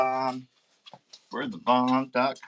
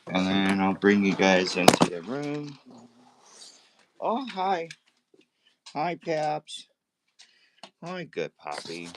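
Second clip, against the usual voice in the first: ordinary delivery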